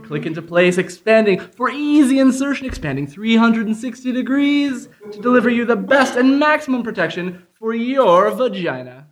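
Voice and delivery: mock commercial read. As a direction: sell it like a TV ad. Improv sketch fake tampon ad.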